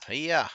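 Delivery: high sharp fighting cry; karate yell. Real, not acted. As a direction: shout it as you strike. Attack yell in game.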